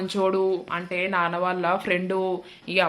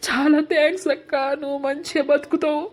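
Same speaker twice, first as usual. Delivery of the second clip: tearful voice building higher into sobbing thanks; crying; 5 semitones higher than usual. Acted. Brother thanking his sister through building tears. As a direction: voice rising and breaking as the tears build. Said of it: "ఎందుకు ఎడుతున్నావు చెప్పు?".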